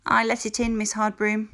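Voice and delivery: tartamudea con voz alta y nerviosa; hesitant. A narrator's reading. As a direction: confiesa dudando, casi con miedo. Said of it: "Mildred said hesitantly".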